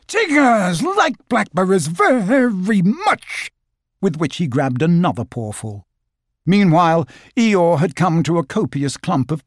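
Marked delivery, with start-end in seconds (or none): 0.1-3.5 high, drawn-out, gleeful declaration; beaming delight. A narrator's reading. Tigger after swallowing his berries, beaming high voice. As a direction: savour each word with huge relish. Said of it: "Tigger beamed broadly and said".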